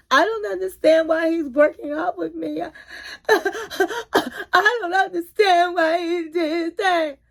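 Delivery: mock crying. [name] hugging the stuffed animal, mock-crying over Rob. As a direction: imitate a sobbing girl clutching a toy. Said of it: "she's crying".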